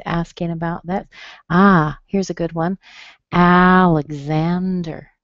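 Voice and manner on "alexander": Alexander drawn out as AL-ig-ZAN-der; third-syllable stress. Shown as a demonstration. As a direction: stretch Alexander slowly, strong first vowel, stressed ZAN. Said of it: "stressed on the third syllable".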